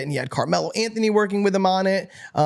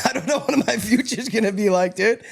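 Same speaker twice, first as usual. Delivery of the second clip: emotional laugh; 5 semitones higher than usual. Real, not acted. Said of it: "I almost get emotional".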